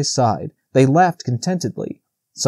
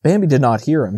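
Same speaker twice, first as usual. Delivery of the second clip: ordinary delivery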